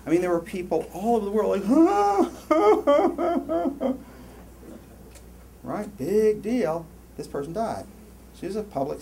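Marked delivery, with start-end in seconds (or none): 1.7-5.4 high, loud, long fake wail; hammed-up sobbing howl trailing away; mock grief wailing. Imitating people mourning Princess Diana, mock wailing. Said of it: "emoted a lot of grief".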